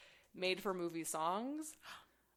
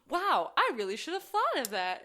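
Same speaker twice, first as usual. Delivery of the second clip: impressed boss; 6 semitones higher, 6 dB louder than usual. Retold or imitated.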